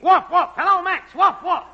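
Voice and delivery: high, loud woofing holler; dog talk. Acted. Technician calling Max over the air, loud dog talk. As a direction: yell woofs like a test signal. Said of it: "this guy with the dog talk".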